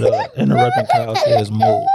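giggling through the punchline; laughing. Real, not acted. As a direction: laugh while you say it. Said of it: "Can't believe I fell for that".

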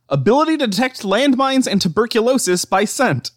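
amazed read-out. Real, not acted. Reads out surprising section heading, amazed.

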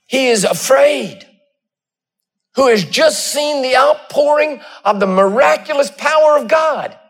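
building sermon cadence, rising and pushing; preaching. Acted. Pastor preaching to his congregation, rising fervor. Preacher telling Elijah story.

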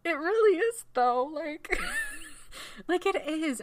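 high-pitched laughing agreement. Real, not acted. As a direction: laugh through the words. Laughing with friend about ending.

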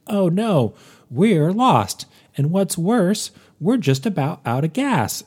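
high, loud hammed-up dismay; mock alarm. Host mimicking campers lost on a drive, exaggerated mock alarm. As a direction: cry it out like a corny movie line.

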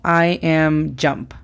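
"I am jump" drawn out clearly; slow teacher quiz; slow model sentence. Shown as a demonstration. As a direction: say "I am jump" very slowly. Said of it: "Y por último".

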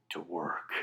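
low, soft tough-guy reply; quiet, deep gunslinger understatement; Eastwood. Acted. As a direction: drop it low and quiet, terse action hero. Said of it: "is that not Eastwood?".

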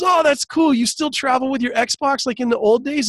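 high-pitched, chummy question; retold friendly comedian. Comedian asking his old friend about the Xbox, high and chummy. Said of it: "he goes, he goes".

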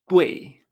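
"Tuei" loud, falling, e in the middle; fourth-tone pronunciation. Shown as a demonstration. Teacher restores the correct form after the error, fourth tone. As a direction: punch "Tuei" downward with a clear e. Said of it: "esto se pronuncia".

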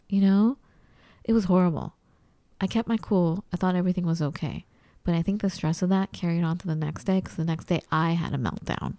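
ordinary talk

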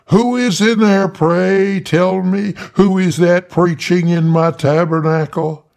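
high, loud holler through a door; Tiny hollers at the locked church door. A narrator's reading. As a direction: call out loud and high, pounding. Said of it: "pounds on the door and hollers".

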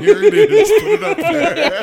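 mock gleeful presenter. Mocking the Monsignor presenting star.

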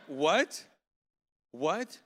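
loud, rising double take; shocked disbelief. Retold or imitated. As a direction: hit each what like a stunned question. Retold shocked reaction.

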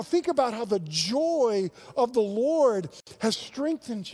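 high, loud, driving sermon voice; fervent preaching. Acted. Pastor urging his congregation to share, in full-force preaching. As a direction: press the point home to the congregation.

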